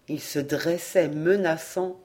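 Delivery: usual speaking voice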